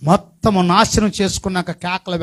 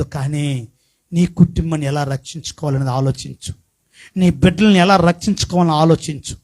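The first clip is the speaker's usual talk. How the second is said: normal talking voice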